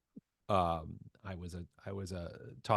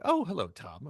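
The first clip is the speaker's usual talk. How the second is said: higher, louder Mid-Atlantic hello; Mid-Atlantic accent; 7 semitones higher, 6 dB louder than usual. Retold or imitated. Chorus teacher meeting a student's friend, Mid-Atlantic accent. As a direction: greet grandly in an imitated Mid-Atlantic accent. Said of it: "the Mid-Atlantic thing".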